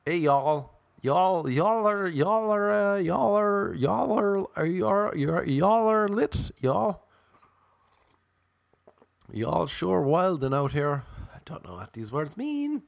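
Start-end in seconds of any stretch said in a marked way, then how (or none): 0.1-11.0 high-pitched mock American drawl; playful put-on American accent, pitched up. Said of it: "and I've become American".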